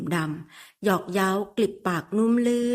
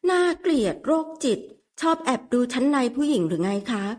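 embarrassed scolding; 5 semitones higher than usual. Acted. Embarrassed woman scolds him.

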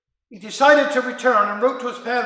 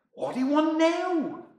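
sharp, higher impatient question; hard-spoken wife; 7 semitones higher than usual. Acted. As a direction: snap at the sick husband upstairs. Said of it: "a very hard-spoken woman".

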